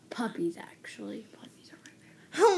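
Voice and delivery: whispering. Real, not acted. Secret whisper to friend.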